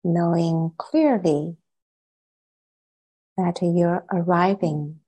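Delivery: unhurried meditation guidance, long silences between phrases; guided meditation. Acted. Teacher settling the group into meditation, calm and spacious. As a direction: guide them calmly, softening as phrases settle.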